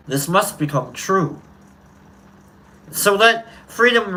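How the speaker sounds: rising, ringing preacher-style delivery with long pauses; oratory speech reading. Acted. Streamer reading the speech to his chat, orating. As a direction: build each "let freedom ring" like a civil rights orator. Recites the famous freedom speech.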